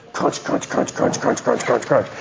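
breathy crunch sounds for the cutters; soft imitated snipping sounds; crunch noises. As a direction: mimic cutters snapping wires. Cutting wires with cutters.

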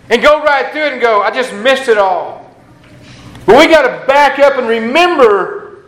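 rising fervent sermon voice; impassioned preaching. Acted. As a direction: lift them up with fervour. Preaching rising to full force.

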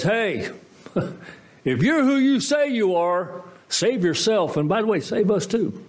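high, laughing sneer at the cross; mocking laugh. Mocking thief taunts Jesus on the cross, laughing and high. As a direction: taunt him with a scornful laugh.

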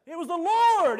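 high, loud proclamation; preaching shout. Retold or imitated. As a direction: hammer it home to the congregation. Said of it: "he was honest and he said".

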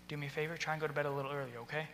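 soft, quick, casual request; gentle advice. Shown as a demonstration. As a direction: quietly nudge a kid, kindly. Gently advising a kid.

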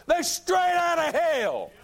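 high, loud and slow preacher's cry; shouted condemnation. Acted. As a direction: roar it from the pulpit. Repeated line, shouted then quiet.

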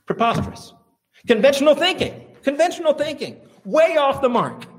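a preacher scoffing at a cigar cure for asthma, loud and high; incredulous outburst. Real, not acted. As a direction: cry out at the absurdity. Preacher mocking old remedy.

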